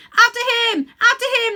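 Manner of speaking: very high, loud hunting cries; excited shouting. A narrator's reading. The animals chase Uncle Andrew, shouting with glee. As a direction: yell like a pack giving chase. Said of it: "they shouted".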